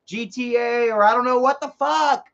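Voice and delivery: normal talking voice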